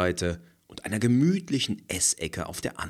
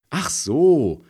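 laughing; 10 semitones higher, 10 dB louder than usual. A narrator's reading. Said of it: "lacht Frederike".

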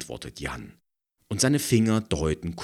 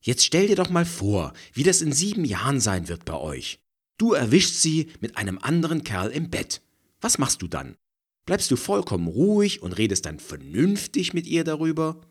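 provocative; 10 semitones higher, 5 dB louder than usual. A narrator's reading. Heated provocation.

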